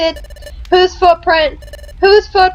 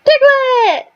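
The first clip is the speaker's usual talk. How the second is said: lightly voiced little mole, higher; Diglett voice; 9 semitones higher than usual. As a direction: give Diglett a small new voice. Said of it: "I have to give it a different voice".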